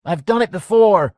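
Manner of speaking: yelling. A narrator's reading. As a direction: yell it through the window. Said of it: "Mike yelled".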